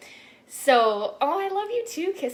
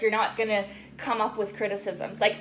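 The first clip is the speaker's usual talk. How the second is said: usual speaking voice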